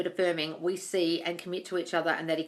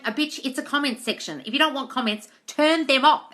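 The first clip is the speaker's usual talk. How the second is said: sassy retort; 5 semitones higher, 5 dB louder than usual. Retold or imitated. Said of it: "I felt like saying".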